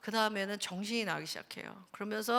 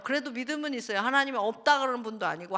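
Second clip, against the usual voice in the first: ordinary delivery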